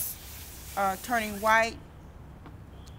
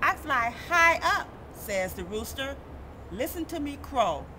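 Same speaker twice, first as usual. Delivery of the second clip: sing-song storybook read with character quotes; reading to children. Acted. Reading a snow picture book to kids, sing-song. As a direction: read it out gently to a young audience.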